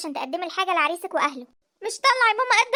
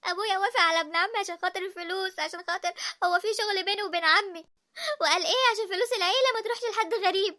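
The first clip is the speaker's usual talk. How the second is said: normal talking voice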